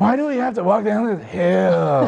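high, whiny imitation of his girlfriend; mock whining. Husband imitating his whining girlfriend walking down a hill. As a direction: whine it like a girl in heels on a hill. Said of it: "she was whining".

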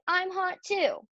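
put-on high, drawn-out kid's complaint; attention-seeking boy, read aloud; Avery's cry. A narrator's reading. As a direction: stretch it out, pitch way up. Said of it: "cried Avery".

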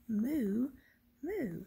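long, drawn-out moo; cow mooing. A narrator's reading. Reader shows children how Mister Brown moos, cow imitation. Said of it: "He can sound like a cow".

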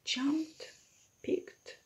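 hushed single word; whisper. Shown as a demonstration. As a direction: breathe it softly. Whispered recap of actions.

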